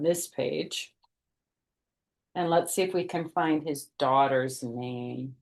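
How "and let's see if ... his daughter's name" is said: ordinary delivery, nothing marked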